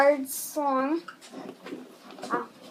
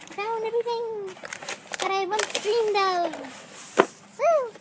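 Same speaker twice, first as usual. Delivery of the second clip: high, slow and dreamy; dreamy joy; 7 semitones higher, slower than usual. Real, not acted. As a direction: swoon over finding them. Joy at finding the clips.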